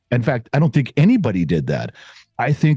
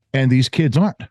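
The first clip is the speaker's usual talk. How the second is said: normal talking voice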